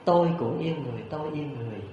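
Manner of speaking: soft, fading forest echo; hushed imitation of an echo; echoing back. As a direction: let the reply drift back and die away. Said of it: "cũng vang vọng là những tiếng".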